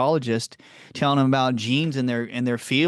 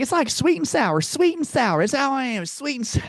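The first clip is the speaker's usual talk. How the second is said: imitating a Southern patient; 13 semitones higher than usual. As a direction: play the sweet Southern patient, affectionately teasing. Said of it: "And she'd be like".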